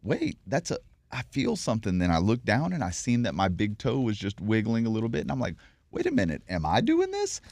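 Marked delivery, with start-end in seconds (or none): 0.0-2.0 higher, breathless wonder at a first feeling; amazed realization. Retold or imitated. Retelling the toe moving, surprised and higher. 5.9-7.4 soaring higher on the last words in disbelief; incredulous question. Retold or imitated. Asking himself about his toe, in high astonishment.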